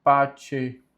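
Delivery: "pace" as "pá-tché", set apart; correct Latin pronunciation. Shown as a demonstration. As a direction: say "pace" with a ch sound for c. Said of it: "a pronúncia correta é". ("pace" com som de tché)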